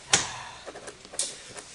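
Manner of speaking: long unvoiced sigh. Performer pausing before admiring the day, sigh. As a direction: let out a heavy breath. Sighing before the monologue line.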